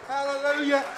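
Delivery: loud, high cry of praise; joyful hallelujah. Real, not acted. Grateful preacher praising God before the congregation, loud and high.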